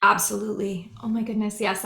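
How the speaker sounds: ordinary delivery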